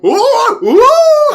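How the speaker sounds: high, loud, hammed-up yelp of alarm; mock panic. Santa slipping off the roof, shrill panicked yelp. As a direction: squeal as you lose your footing. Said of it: "And then Santa's like".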